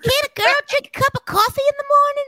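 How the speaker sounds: Rogue voice. Acted. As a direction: pitch way up into a cartoon girl. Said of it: "Is that your Rogue?".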